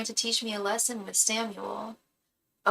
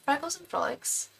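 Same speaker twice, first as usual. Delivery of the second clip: a light mock British accent, higher; 7 semitones higher, 5 dB quieter than usual. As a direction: say the name like a Brit in her head. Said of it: "I read it with a British accent".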